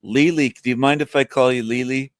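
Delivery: ordinary delivery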